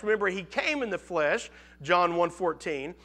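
normal talking voice

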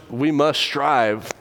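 slow, with the weight landing on "strive"; stress on strive. Shown as a demonstration. Preacher urging fathers, slow with stress on "strive". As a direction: lean hard on "strive", stretched out and slow. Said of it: "the emphasis on that word there".

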